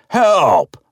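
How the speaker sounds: loud, very high shriek. A narrator's reading. Old man shrieks for help again, loud and high. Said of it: "starts shrieking".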